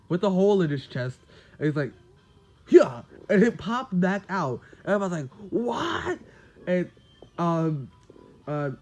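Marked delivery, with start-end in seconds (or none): 2.7-3.0 a much louder, punchy "yeah". Retold or imitated. Deku stands up with hole in chest. 5.5-6.2 a high, amazed "what?"; stunned what. Retold or imitated. Everybody stunned by Deku recovering.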